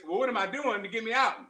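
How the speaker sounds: normal talking voice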